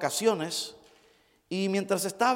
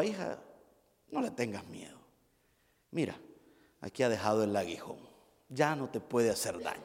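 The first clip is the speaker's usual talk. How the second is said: softer, lower calming voice; reassuring father; 8 semitones lower, 9 dB quieter than usual. Retold or imitated. Father calms his frightened daughter. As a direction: soothe the scared child quietly.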